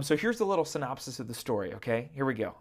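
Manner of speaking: ordinary delivery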